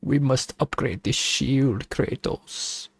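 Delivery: a lightly hammed German advisor urging Kratos; German accent. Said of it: "Why are you speaking like this?".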